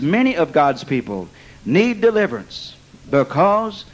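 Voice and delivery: ordinary delivery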